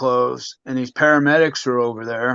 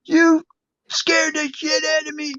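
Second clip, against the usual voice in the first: mock a frantic mother's telling-off, pitched way up; imitating mom yelling; 13 semitones higher than usual. Son imitating his mom yelling at him after the fall, scolding. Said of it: "she's just yelling at me".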